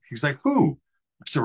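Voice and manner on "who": drill instructor. Retold or imitated. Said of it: "it was my drill instructor".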